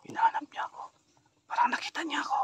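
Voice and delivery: hushed whisper while hiding; scared unvoiced whisper. Real, not acted. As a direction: breathe it so she won't hear. Hiding from the witch in game.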